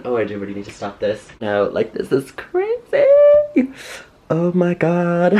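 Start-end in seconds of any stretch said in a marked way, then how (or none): 1.9-5.3 incredulous. Real, not acted. Disbelief at the pile.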